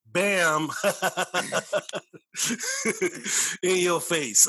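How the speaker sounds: loud, high triumphant "Bam!" breaking into laughter; playful gloat. Real, not acted. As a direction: rub it in with a cheeky laugh. Gloating over a joke.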